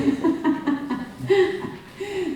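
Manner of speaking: high, bubbling burst of laughter; real laugh. Real, not acted. Medium relays a spirit's teasing remark, breaking into laughter. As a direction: laugh out long and freely.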